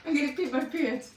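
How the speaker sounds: can't stop laughing; real giggling speech; laughing while talking. Real, not acted. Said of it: "Don't make me laugh".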